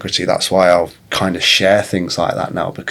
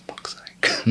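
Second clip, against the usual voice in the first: muttered thought; 12 dB quieter than usual. Retold or imitated. Irritated thought while busy.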